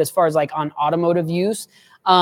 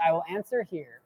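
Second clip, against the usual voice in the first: normal talking voice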